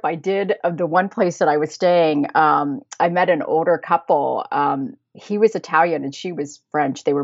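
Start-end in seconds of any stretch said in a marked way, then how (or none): none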